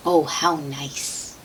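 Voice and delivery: ordinary delivery, nothing marked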